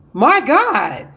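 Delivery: high, slow, drawn-out amazement; amazed. Real, not acted. Healer reacting in awe to viewers' reports, high and slow. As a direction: stretch out each exclamation in wonder.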